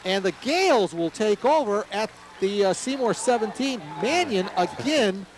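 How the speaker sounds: rising excited call of the play. Real, not acted. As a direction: call it high and fast as the play breaks down. Punt snap goes wrong, live call.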